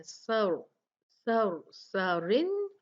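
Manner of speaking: सर with a thick, full r, slow; heavy ra. Shown as a demonstration. Teacher drills the heavy ra slowly. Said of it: "वो मोटी पढ़ी जाती है।".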